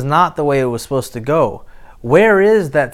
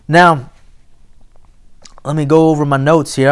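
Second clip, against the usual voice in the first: ordinary talk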